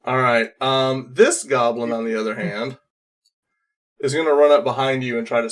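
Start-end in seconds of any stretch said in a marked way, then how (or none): none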